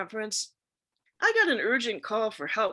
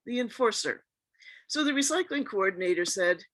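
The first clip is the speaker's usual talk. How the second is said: normal talking voice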